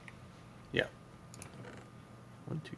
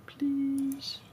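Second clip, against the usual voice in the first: high squeaky please; 3 dB quieter than usual. Pretend. Pleading with the machine.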